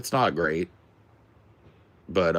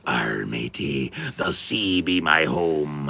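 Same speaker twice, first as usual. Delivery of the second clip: put-on slow gruff old fisherman pirate; slower than usual. Shown as a demonstration. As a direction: growl it like an old sea dog. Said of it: "if you're a pirate".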